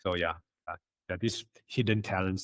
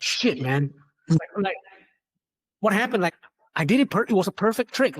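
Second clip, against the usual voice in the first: frustrated; 9 semitones higher, 4 dB louder than usual. Retold or imitated. Retold frustration over likes.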